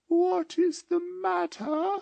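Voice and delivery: very high, slow, eerie; an otherworldly spirit asking gently; slow high ghost voice; ghostly spirit voice. A narrator's reading. The spirit speaks.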